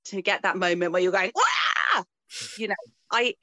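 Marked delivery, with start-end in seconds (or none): 1.3-2.0 high, loud wordless yell of amazement; sudden excited "wah" shout; amazed crowd yell. Acted. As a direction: cry out high like a thrilled spectator. Acts out crowd's amazed cry.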